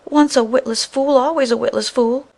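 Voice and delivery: high, loud taunting insult; mock him loudly as a fool; scornful scolding. A narrator's reading. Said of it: "her vexation and ill temper".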